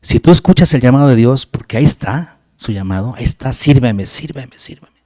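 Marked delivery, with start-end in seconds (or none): 3.5-5.0 hushed, breathy call of God; soft insistent whisper. Acted. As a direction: whisper it like a voice calling from within. God's call voiced as whisper.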